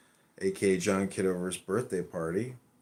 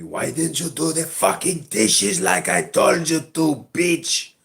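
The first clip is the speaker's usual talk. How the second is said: loud, high-pitched, drawn-out angry tirade; screaming at partner; 8 semitones higher, 10 dB louder, slower than usual. Retold or imitated. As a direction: scream it like a furious nagging partner. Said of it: "She starts screaming at me".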